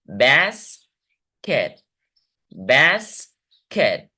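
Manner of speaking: बैस rising, pause, खैर short and falling; teacher's two-beat demo of बैस - खैर; syllable by syllable. Shown as a demonstration. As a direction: chop basket into बैस and खैर. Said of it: "बास्केट में दो syllables हैं".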